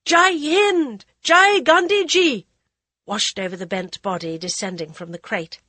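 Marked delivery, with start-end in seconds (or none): 0.1-2.5 high, loud, slow chant; crowd cries. Acted. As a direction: cry out to the leader. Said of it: "Cries of".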